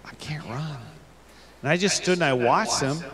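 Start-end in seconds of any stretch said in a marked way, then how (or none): 0.0-0.8 quiet realization. Acted. Girl's sad realization.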